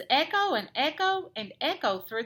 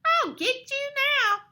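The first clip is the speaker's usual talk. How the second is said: high, menacing bat squeal; high-pitched villain bat; squeaking bat; 13 semitones higher, 3 dB louder than usual. A narrator's reading. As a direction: threaten in a piercing cartoon bat voice. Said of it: "squeaks Bad Egg".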